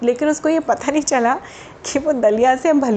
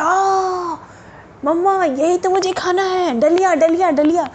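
put-on excited child voice; 6 semitones higher than usual.

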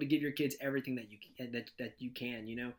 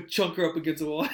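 words through real laughter; laughing; 2 semitones higher, 9 dB louder than usual. Real, not acted. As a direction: laugh it out, loud and bright. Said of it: "laughing while telling".

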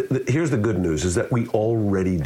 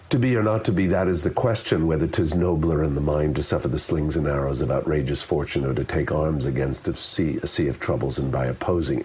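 rattled-off monotone recital, sinking lower; no vocal variety; 5 semitones lower than usual. Shown as a demonstration. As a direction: run it all together without shaping any phrase. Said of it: "in the absence of vocal variety".

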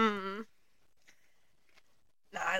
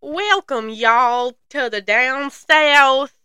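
slow, drawn-out Southern welcome, louder and higher; Southern Florida accent intro; 4 semitones higher, 3 dB louder, much slower than usual. Shown as a demonstration. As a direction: greet them like a hammed-up Florida host. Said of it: "supposed to be a Floridan accent".

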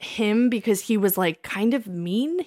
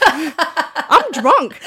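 laughing mock complaint; 7 semitones higher, 6 dB louder than usual. Retelling getting home drunk.

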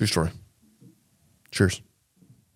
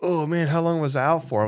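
higher, louder mock of a groggy kid; dazed kid waking; 7 semitones higher, 3 dB louder than usual. Kid waking from passing out, mock dazed high voice. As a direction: act just revived and confused. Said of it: "And he wakes up".

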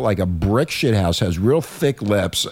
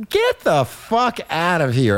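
drawn-out, high-pitched incredulous retort; slow, raised-pitch scoffing quote; retold disbelief; 6 semitones higher, slower than usual. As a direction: act out not believing a friend, stretched slow. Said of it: "And I went".

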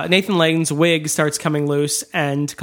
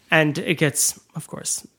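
ordinary talk, nothing marked

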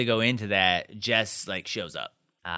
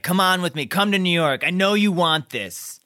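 loud, raised, insistent pleading; almost yelling; 4 semitones higher, 5 dB louder than usual. Retold or imitated. Jess urging her to run away, almost yelling. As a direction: imitate a pushy demand, just short of a yell. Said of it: "almost yelling, just being like".

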